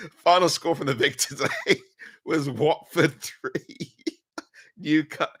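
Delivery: reading the score while giggling; can't hold back the laughter; laughing through it. Real, not acted. Fan reading result to viewers, laughing helplessly.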